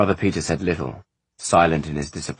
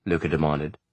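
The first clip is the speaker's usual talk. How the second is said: ordinary delivery, nothing marked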